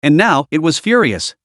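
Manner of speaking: plain reading voice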